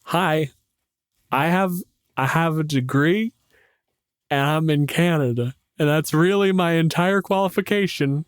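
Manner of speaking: a lightly voiced awkward intro; sheepish understatement. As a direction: play down your credentials with pauses. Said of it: "rattle off my credentials".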